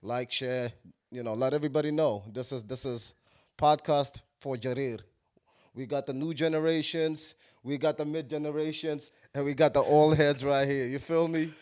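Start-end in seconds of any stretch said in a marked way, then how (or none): none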